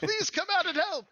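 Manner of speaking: loud, very high cry for help; high-pitched begging shout; Sir Talavar pleading. Acted. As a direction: call out desperately in a squeaky character voice. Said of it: "he says that a couple times".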